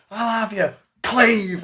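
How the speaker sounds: high, loud fighting roar; raging battle yell. Retold or imitated. Surrounded fighter taking on the high guards, raging yell. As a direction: bellow it as you strike. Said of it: "absolutely raging as people start to surround me".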